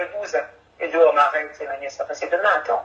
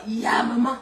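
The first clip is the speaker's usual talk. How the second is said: ordinary talk, nothing marked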